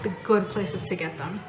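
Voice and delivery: usual speaking voice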